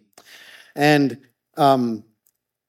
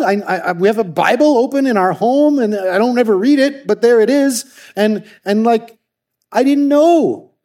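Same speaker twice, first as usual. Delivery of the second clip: higher and louder, indignant and pained; upset disbelief; 6 semitones higher, 4 dB louder than usual. Retold or imitated. Louisa confessing she never knew God, upset and indignant. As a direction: pour out the frustration. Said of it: "She was angry".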